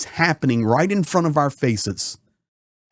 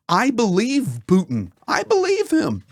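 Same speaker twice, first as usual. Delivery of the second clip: "I believe him" jumps much higher in a sneering mock; mock Trump; 8 semitones higher than usual. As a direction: sneer at it, pitch "believe" up high. Mocking Trump's stance.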